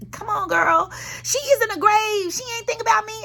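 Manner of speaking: high, teasing sass at her mother; playful mock dismissal. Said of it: "I'm just playing".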